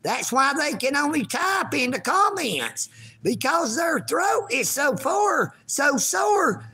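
high, sassy voiced pet cat. Owner voices his kitty cat mocking commenters, high and sassy. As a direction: speak for the cat in a high put-on voice. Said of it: "Cat, you be talking like that".

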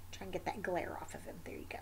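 unvoiced aside under the breath; whispered aside while adjusting the picture. Real, not acted.

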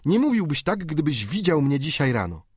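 student answering his friend's jibe; Bianchon's voice. Acted. Bianchon retorts. (wyraźnie wyższy głos młodego medyka, bez urazy)

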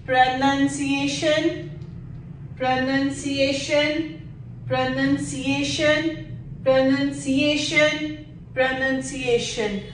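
"pronunciation" slow, five even takes; slow repeat-after-me. Shown as a demonstration. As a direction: drill it for the class to echo. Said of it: "Speak after me five times".

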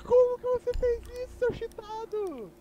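can't believe you pulled that off; incredulous. Real, not acted. Accusing the teammate of cheating.

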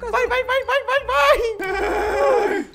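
high frantic laughing yells; laughing urgent shout. Real, not acted. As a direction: urge them on, laughing. Urging friends to run.

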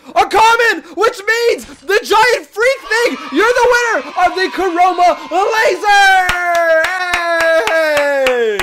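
high, full-out hype shout; excited winner announcement. Real, not acted. Host shouting that someone won a Chroma Laser, wildly excited. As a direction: shout it huge, stretching the final words.

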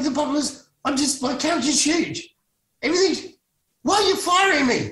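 very high-pitched mock outrage; imagined indignant protest. Soap star to bosses cutting his contract, mock indignant. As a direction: protest in a squeaky, hammed-up plea. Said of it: "In, in my head".

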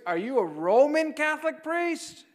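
very high, loud, slow question; imitated interrogation. Tattooed man pressing the priest further, high loud questioning. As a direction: stretch it into an exaggerated challenge.

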